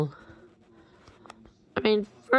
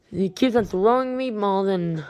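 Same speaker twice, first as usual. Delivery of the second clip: ordinary talk, nothing marked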